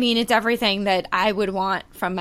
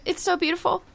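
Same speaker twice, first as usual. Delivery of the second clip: choked up; 9 semitones higher, 3 dB quieter, faster than usual. Real, not acted. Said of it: "I'm getting emotional".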